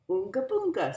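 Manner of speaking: grandparents voice. A narrator's reading. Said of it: "said Grandma and Grandpa".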